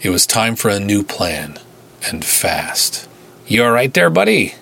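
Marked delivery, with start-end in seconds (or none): 3.5-4.6 put-on thick northern Minnesota accent; friendly Minnesota officer voice. A narrator's reading. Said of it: "thick northern Minnesota accent".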